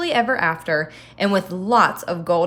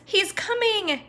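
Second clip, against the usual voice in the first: higher, panicked giantess warning; alarmed cry; 13 semitones higher than usual. Acted. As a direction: squeal the warning in a hurry. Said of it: "cried the giantess".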